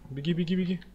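ordinary talk

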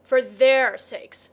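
loud, drawn-out indignant echo; booming, slow retort. A narrator's reading. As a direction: throw the words back loudly and slowly. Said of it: "very loudly".

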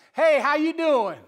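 loud, drawn-out, overfamiliar greeting; high, slow mock holler to a boss; disrespectfully loose. Deputy greeting his sergeant too casually, disrespectfully loose. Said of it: "in some disrespectful, loose way".

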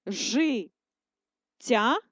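життя stretched into syllables; slow syllable dictation. Shown as a demonstration. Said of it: "Ділимо для переносу".

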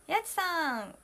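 ordinary talk

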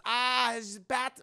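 put-on slow comic German accent; thick German accent. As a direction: greet in a hammy German accent. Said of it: "a very thick German accent".

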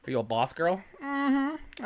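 ordinary talk, nothing marked

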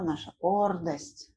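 ordinary talk, nothing marked

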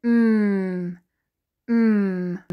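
"mm" as a long, steady hum; letter M sound. Shown as a demonstration. Teacher answering what M says, sustained "mm". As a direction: hum "mm" with lips closed. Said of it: "The M says".

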